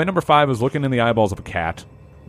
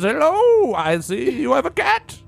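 put-on light old prospector voice; hint of a creaky old-timer; 9 semitones higher than usual. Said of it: "And he says".

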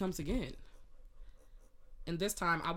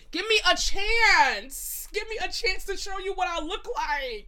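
pleading outburst; 11 semitones higher, 8 dB louder than usual. Real, not acted. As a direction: stretch out a dramatic plea. Begging the absent man.

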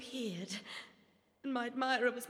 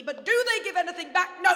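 fast, louder bitter snap ending in a shout; snapping outburst; 5 semitones higher, 10 dB louder, faster than usual. Acted. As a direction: lash out, then bite off the No. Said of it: "I didn't mean to snap".

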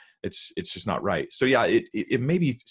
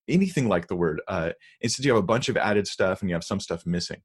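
ordinary talk

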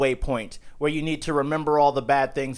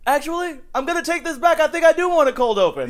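Seinfeld pitch voice; 12 semitones higher, 4 dB louder than usual. Pretend. Playing Seinfeld in pitch room.

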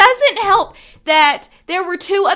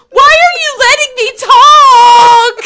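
laughing desperate shout; 12 semitones higher, 12 dB louder than usual. Retold or imitated. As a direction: shout in mock despair at herself. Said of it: "I'm like".

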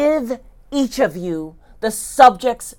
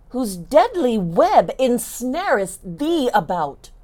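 ordinary talk, nothing marked

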